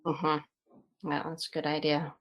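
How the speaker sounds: ordinary talk, nothing marked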